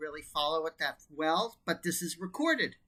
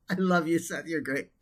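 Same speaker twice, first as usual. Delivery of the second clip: usual speaking voice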